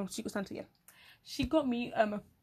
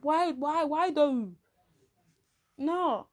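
exasperated; 5 semitones higher, 4 dB louder, much slower than usual. Retold or imitated. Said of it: "I'm like".

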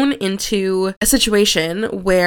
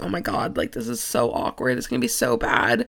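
mortified inner thought; 2 semitones lower, 7 dB quieter than usual. Retold or imitated. Client thinking to herself as she tears up on a coaching call, mortified and hushed. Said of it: "And I was mortified".

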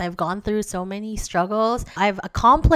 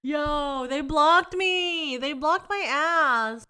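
slow, higher mock outrage; disbelief; 6 semitones higher, slower than usual. Real, not acted. Telling viewers her exes blocked her, slow disbelief. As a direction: savor the indignity, slowly.

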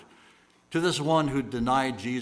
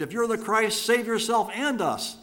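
retold scream; 9 semitones higher, 4 dB louder than usual. A narrator's reading. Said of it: "had screamed".

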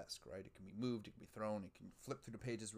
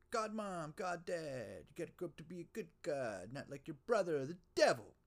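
higher-pitched scolding godparent bit; mock God's parent; 8 semitones higher, 5 dB louder than usual. Joking about God's parents and the devil brother, mock scolding. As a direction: play a parent grumbling about the no-good son. Plays out God's family story.

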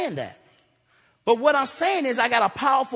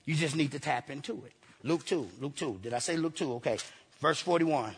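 much lower and softer as he finds the verse; quiet aside; 8 semitones lower, 9 dB quieter than usual. Real, not acted. Preacher checking his Bible passage, low and soft. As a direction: drop out of the sermon to mutter the reference.

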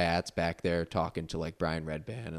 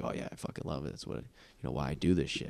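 softer, almost whispered low monotone; Jeff Ross monotone; 4 semitones lower, 5 dB quieter than usual. Retold or imitated. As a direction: imitate a low-key guy who keeps to himself. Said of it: "very, like, monotone".

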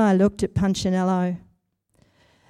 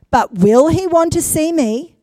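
higher, louder anxious call; yelled out after her; 6 semitones higher, 5 dB louder than usual. A narrator's reading. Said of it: "yelled out after her".

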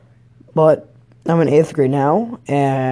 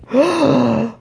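exaggerated gasp of horror; mock shocked gasp; 9 semitones higher than usual. Para hearing a student swear, mock shocked gasp. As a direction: imitate a scandalized, sharp inhale. Imitates para's shocked reaction.

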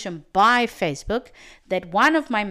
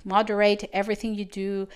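ordinary talk

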